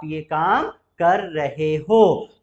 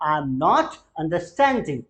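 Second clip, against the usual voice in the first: very slow, careful words; slow model negative; 3 semitones lower, much slower than usual. Shown as a demonstration. Teacher models the negative answer slowly. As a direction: say it word by word. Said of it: "नहीं आ रहा है".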